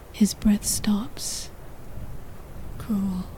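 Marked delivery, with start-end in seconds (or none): 2.8-3.2 soft, understated murmur of reproach; whisper. A narrator's reading. Masked man reproaching his lover, in a soft whisper. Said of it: "he whispers".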